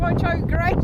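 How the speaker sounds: high, loud shout of warning. Real, not acted. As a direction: yell out a sudden warning. Warning dog during play.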